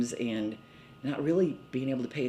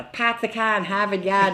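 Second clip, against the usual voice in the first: imitating a Boston accent, dropping the r's, higher and louder; mock Boston local saying the classic line; 7 semitones higher, 6 dB louder than usual. Telling of the accents she loved, in a Boston accent. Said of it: "I just loved the accents".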